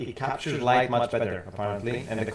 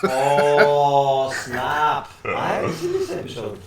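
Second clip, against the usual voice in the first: hollow, tubey mic sound; like talking inside a pipe; through a tube; 3 semitones higher, 6 dB louder, slower than usual. Real, not acted. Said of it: "They're saying you sound like you're in a tube".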